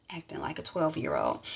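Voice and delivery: a whispered side remark; whispered aside. Real, not acted. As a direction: mutter it under your breath. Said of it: "aside comment".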